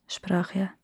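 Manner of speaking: plain reading voice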